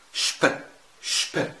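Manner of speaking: شب بشين تقيلة; انطق شب بشين تقيلة واضحة; heavy sch. Shown as a demonstration. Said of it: "إزاي؟". (نطق شب بشين تقيلة)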